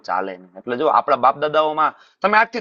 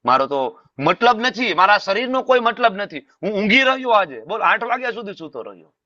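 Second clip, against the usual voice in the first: higher-pitched mock scolding of oneself; acted self-reproach; 7 semitones higher than usual. Devotee scolds himself for oversleeping, acted self-reproach. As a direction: berate yourself in mild exasperation. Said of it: "ગુસ્સો આવે".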